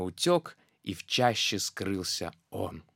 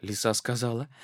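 ordinary delivery

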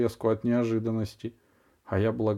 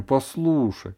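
plain reading voice

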